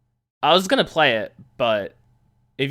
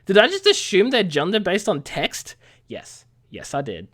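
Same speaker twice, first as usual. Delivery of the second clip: ordinary talk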